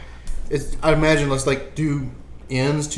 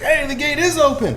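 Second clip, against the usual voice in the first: alarm shout; 12 semitones higher, 3 dB louder than usual. Acted. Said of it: "Can I lend to that? Like".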